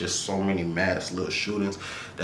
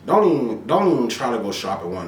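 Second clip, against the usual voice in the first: jumps up high and loud on don't even; loud sudden warning; emphatic warning; 4 semitones higher, 7 dB louder than usual. Real, not acted. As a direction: cut in hard to warn them off. Warning about pricey grocery stores.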